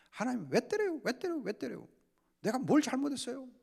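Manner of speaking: high, pleading whine that fades; a sulky believer pleading "why"; imitating a whining complaint. (억울한 듯 하나님께 높게 칭얼대는 항의)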